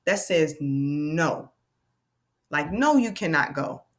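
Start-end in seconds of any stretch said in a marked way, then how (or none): 0.0-1.5 slow, drawn-out no; teacher modelling long O; long o sound. Shown as a demonstration. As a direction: stretch the no. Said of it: "listen to the sound".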